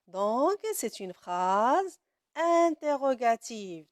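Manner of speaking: ordinary talk, nothing marked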